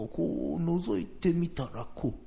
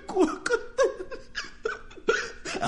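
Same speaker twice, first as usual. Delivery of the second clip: high, halting voice through sobs, stammering; sobbing excuse. Acted. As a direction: blubber the excuse like a scared child.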